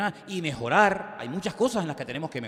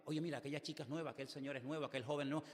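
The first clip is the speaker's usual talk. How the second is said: hushed aside; 3 semitones lower, 15 dB quieter than usual. Retold or imitated. Nudging neighbour about newcomers.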